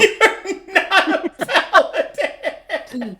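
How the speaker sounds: high, loud cry through laughter; laughing accusation. Real, not acted. Player teasing another about being a paladin, laughing loudly.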